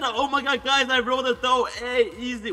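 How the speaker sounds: high, loud imitation of bragging; mock excited boast. Gamer reads out a player's boast, mock excitement. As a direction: mimic a player's thrilled brag, hammed-up.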